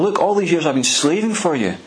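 high-pitched aggrieved protest, rising in pitch as it goes; a resentful son listing every grievance; older son protesting to his father, mocking and aggrieved; indignant complaint. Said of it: "the older son says to father".

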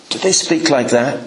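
normal talking voice

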